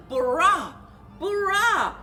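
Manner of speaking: ordinary delivery, nothing marked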